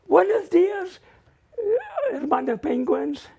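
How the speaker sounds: put-on high, loud kid's greeting; gullible little kid. Gullible little boy greeting nuns as coached, naive and loud. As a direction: blurt it out like an eager little kid. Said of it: "being the gullible little kid that I am".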